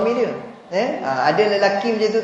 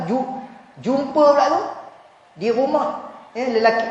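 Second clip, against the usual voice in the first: normal talking voice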